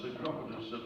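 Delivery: normal talking voice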